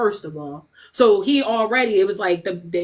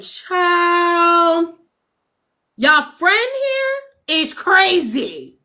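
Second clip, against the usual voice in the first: higher, slow sassy drawl; sassy remark; 7 semitones higher, much slower than usual. Retold or imitated. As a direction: throw shade at the lawyers. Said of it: "I said".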